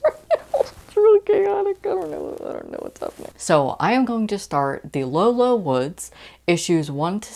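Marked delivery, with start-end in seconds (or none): 0.0-2.4 squeaky high, loud comic voice; mock overwhelmed squeal. Real, not acted. Vlogger swamped by too many books at once, squeaky comic squeal. 2.4-3.3 breathless comic whisper. Real, not acted. Vlogger admitting reading chaos to viewers, hushed whisper. Overwhelmed by too many books.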